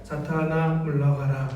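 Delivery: 낮고 작게, 높낮이 없는 기계 말투; 감정을 완전히 빼고 기계처럼 말하기; 감정 없는 전자음. Shown as a demonstration. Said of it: "전자음처럼 감정 없이 소모 없이". (전자음처럼 감정 없이 낮게)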